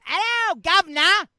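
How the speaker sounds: loud, high-pitched hammed-up Cockney greeting; mock Cockney accent. American overdoing "something British" for a Brit, in loud mock Cockney. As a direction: belt out a cartoon Cockney hail. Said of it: "you would have insulted yourself".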